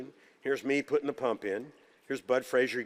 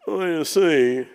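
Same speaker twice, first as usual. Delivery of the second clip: put-on higher, louder, slow voice of an old Southern surgeon; a loud, higher, slowed-down senior-surgeon voice; imitating DeBakey; 5 semitones higher, 8 dB louder, slower than usual. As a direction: put on the grand old surgeon, drawing out the words. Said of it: "I learned to imitate all these guys".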